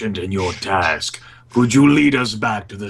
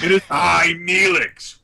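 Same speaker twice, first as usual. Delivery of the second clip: higher, hammed-up self-announcement; mock grand entrance; 8 semitones higher, slower than usual. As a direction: parody the dramatic reveal. Player mocks the dramatic entrance.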